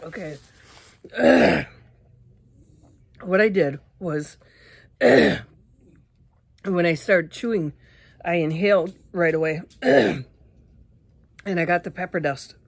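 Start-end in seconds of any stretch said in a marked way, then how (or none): 1.0-1.6 real dry unvoiced cough; strained dry cough; breathy cough. Real, not acted. Pepper dust in throat. 9.7-10.2 real loud choking cough; hacking cough. Real, not acted. Pepper dust in throat.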